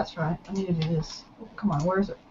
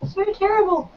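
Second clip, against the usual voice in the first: voice cracking up an octave; 11 semitones higher, 3 dB quieter than usual. Real, not acted. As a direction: voice jumping high and breaking. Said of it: "My voice cracked again".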